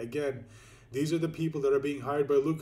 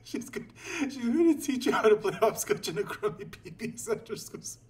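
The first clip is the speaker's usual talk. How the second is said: laughing; 12 semitones higher than usual. Real, not acted. Mocking character while laughing.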